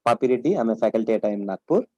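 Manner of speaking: normal talking voice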